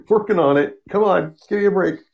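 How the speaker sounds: high, whiny mock plea; mock exasperated excuse. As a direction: whine it like a harried boss brushing off blame. Mocking Monitor's excuse again.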